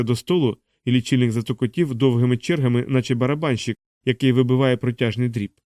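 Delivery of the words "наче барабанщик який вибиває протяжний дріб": ordinary delivery, nothing marked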